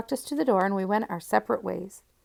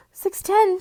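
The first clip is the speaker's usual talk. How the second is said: high, loud startled cry; cried in alarm; 12 semitones higher, 7 dB louder than usual. A narrator's reading. Mary Ann sees she is late, high loud alarmed cry. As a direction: blurt the time out in shock. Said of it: "she cried".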